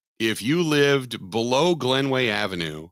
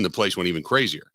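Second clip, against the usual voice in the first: ordinary talk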